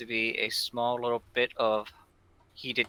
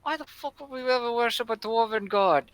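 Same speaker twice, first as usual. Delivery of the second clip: mocking bandit; 12 semitones higher than usual. Bandit mocks dwarven god.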